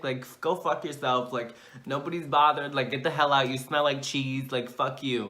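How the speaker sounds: ordinary talk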